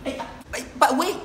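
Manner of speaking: usual speaking voice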